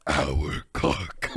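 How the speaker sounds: very low monster voice; Venom. Acted. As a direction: drop deep into a creature's voice.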